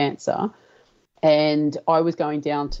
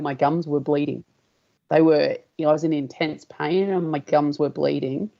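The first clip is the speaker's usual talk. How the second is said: usual speaking voice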